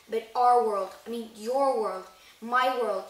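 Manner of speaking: loud punches on "our", "your", "my"; emphatic insistence. Acted. Hagrid spelling out which world, stressing "our", "your", "my". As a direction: hit "our", "your" and "my" hard.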